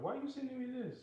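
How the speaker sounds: high-pitched imitation of his son; mock-baffled son voice; mimicking son, high. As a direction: squeak it out like a confused kid. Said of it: "My son was like".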